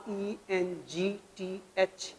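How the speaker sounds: ई एन जी टी एच spelled slowly, letter by letter; spelling out letters. Shown as a demonstration. Teacher spelling a word aloud for students, slowly. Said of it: "एस टी आर".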